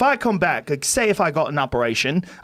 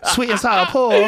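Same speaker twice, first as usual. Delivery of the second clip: put-on Chinese accent, higher pitched; caricatured Chinese accent; mock Chinese accent; 5 semitones higher than usual. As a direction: mimic a Chinese-accented food order. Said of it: "with a Chinese accent".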